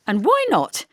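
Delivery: high, loud indignant retort. A narrator's reading. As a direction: bristle back at the teasing. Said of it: "she said indignantly".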